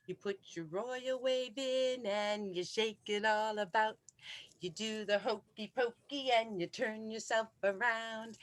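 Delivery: ordinary delivery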